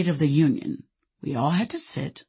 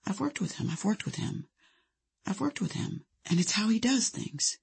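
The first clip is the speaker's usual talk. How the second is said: very soft, soothing, higher voice; calm, meditative; 5 semitones higher, 9 dB quieter than usual. A narrator's reading. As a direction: repeat it gently like a meditation. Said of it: "She repeated in her calm, meditative voice".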